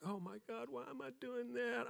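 high, thin panicked thought; rising inner panic. Retold or imitated. Preacher reliving his stage panic, high panicked thought. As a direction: pitch it up like dread creeping in.

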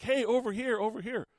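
imitated calling out. Fan signaling the mascot across the stands, imitated call. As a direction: imitate flagging someone down, not really shouting. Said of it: "he's like, he's signaling".

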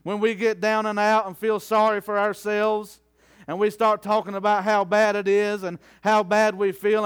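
ordinary talk, nothing marked

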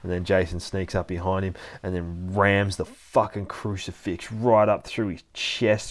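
ordinary talk, nothing marked

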